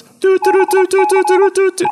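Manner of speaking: high sung doo doo jingle; sound effect hum. Shown as a demonstration. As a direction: sing the jingle high and loud. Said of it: "a sound effect that goes".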